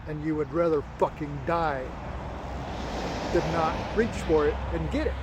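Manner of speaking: inner voice. Retold or imitated. Inner voice to exhausted runner, measured and solemn. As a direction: let a hint of solemn inner voice speak. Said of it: "There was something inside me that said".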